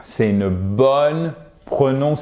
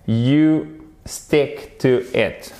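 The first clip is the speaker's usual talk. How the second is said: word by word, drawn out; slow teaching repeat; much slower than usual. Shown as a demonstration. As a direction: say it slowly for learners to follow. Teacher repeats phrase for learners. (répétition lente et détachée)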